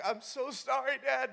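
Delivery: high, tearful mock apology; mock crying. Injured son apologizing to his dad, mock crying. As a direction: whimper it like a crying teen, played for laughs. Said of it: "and I start crying".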